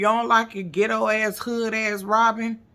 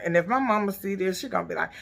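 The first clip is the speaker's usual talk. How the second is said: ordinary talk, nothing marked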